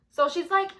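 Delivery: ordinary delivery, nothing marked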